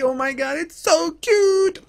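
high, slow cooing over a puppy; squeaky, drawn-out puppy talk; gushing baby voice. Acted. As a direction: coo at the cute little dog.

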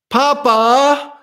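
high, loud little-swan call; a young child calling up to his father; child calling dad. A narrator's reading. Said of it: "rief Stefan seinem Vater zu".

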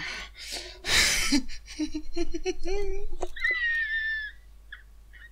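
long, high, shouted laughter; scream-laugh with joy. Real, not acted. Streamer screams with laughter over a rare drop.

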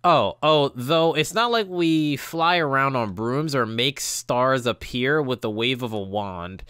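ordinary talk, nothing marked

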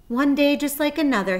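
high, loud despairing groan. A narrator's reading. Lonely pig groaning to himself, high and loud. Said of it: "he groaned".

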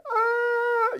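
sudden high cry, loud; high wail. Retold or imitated. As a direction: let out a high wailing ah. Imitating onset of crying.

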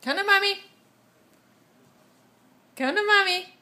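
sing-song high coaxing call. Real, not acted. Calling baby to come.

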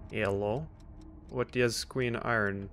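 ordinary talk, nothing marked

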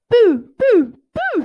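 high, loud playful boos; chuckling boos. Acted. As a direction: giggle out the boos. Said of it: "he chuckled".

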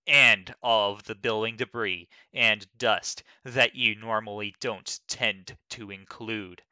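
normal talking voice

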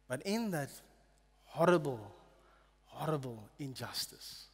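hushed wonder at the sweet embrace; tender and slow. Real, not acted. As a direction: let each repeated word sink in gently. Preacher lingers on the cross.

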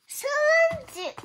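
sudden high exclaim at a mess-up; surprised outburst. Real, not acted. Child reading aloud, startled by a mistake, high surprise.

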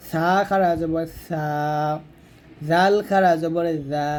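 lisped th-sounds in "সা" and "জা", last vowel held long; tongue tip on upper teeth for "সা", "জা", then stretch them; tongue-tip articulation. Shown as a demonstration. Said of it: "জিহ্বার আগাটা উপরে দাঁতের আগা লাগিয়ে".

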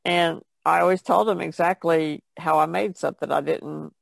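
ordinary talk, nothing marked

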